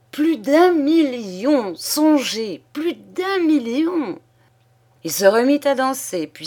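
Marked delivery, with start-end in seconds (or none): 0.1-4.2 high, drawn-out elated cry; joyful shouting. A narrator's reading. Father-in-law exulting over the inheritance, shouting. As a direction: shout it slowly, savouring the fortune. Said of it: "Il criait".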